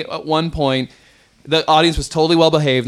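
normal talking voice

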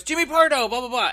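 loud, high imitation of an excited greeting; hammed-up JB Smoove greeting; impression of J B Smoove. Impersonating J B Smoove greeting Jimmy, high and loud. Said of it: "And J B goes".

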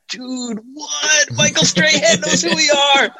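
high-pitched squeal of mock astonishment; starstruck amazement. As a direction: build from hushed shock to a thrilled cry. Acts out starstruck reaction.

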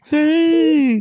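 high, squealed mock outrage; mock protest. Co-host objecting to a cake joke, high-pitched mock protest.

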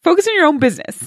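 brisk, high parental reprimand; scolding mom. Retold or imitated. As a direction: rattle it off like a fed-up mother. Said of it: "my mom would be like".

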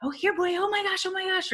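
high, gushing excited call to a dog; high thrilled puppy greeting. Retold or imitated. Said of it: "the way that you call a dog is with a lot of excitement".

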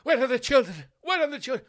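high, loud panicked cries; frantic mother. Pretend. Posh mother frantically searching the mansion for her children. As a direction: rush about in a high shrieking panic. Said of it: "The mother frantically going round".

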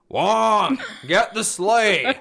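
loud, high, slow villain proclamation; hammed-up villainous battle cry; Grinch. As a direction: draw out each word like a gleeful cartoon villain. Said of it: "this Grinch thing happening".

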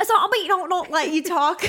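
high, giggly fake British babble; badly faked British, garbling the words; attempted British accent. Showing co-host a hopeless attempt at British accent. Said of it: "I can't even".

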